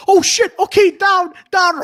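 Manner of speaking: high loud panicked command; panicked yell. Retold or imitated. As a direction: yell at the charging dog. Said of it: "all I hear is".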